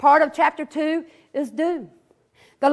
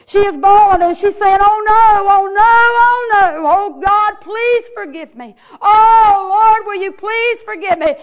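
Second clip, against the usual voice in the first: loud, high wailing plea; preacher's full-voiced imitation of a woman bawling; 5 semitones higher, 9 dB louder than usual. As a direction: cry out to God for mercy, stretching the cries. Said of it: "Missus Hamilton is bawling. She is bawling".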